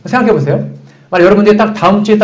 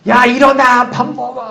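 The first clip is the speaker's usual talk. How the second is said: mock parent yelling; 7 semitones higher, slower than usual. Pastor imitating a parent waking kids, mock high yell. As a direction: play a frazzled parent rousing the kids, lightly.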